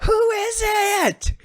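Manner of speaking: very high, far called-out question; calling out from crowd. Retold or imitated. Fan shouting to [name] on stage, high call-out. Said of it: "a man in the crowd who calls out".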